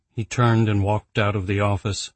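ordinary delivery, nothing marked

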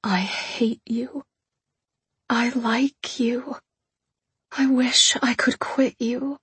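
slow, whispery confession; pained. A narrator's reading. Kneeling fiancée confessing to the man humiliating her, pained whisper. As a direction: whisper it slowly, full of pain and self-hatred. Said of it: "a groan of pain and self-hatred escaped my mouth".

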